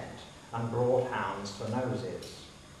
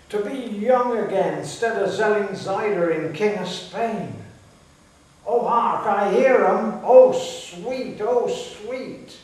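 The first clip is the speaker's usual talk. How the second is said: put-on high, loud old countryman yelling; an old gardener bellowing with delight as the hunt streams past; a village old-timer shouting to his family, high and loud; Old Joe hollering; 10 semitones higher, 10 dB louder than usual. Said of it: "Old Joe ... said".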